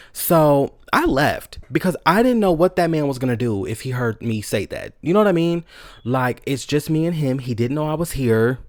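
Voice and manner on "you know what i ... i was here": usual speaking voice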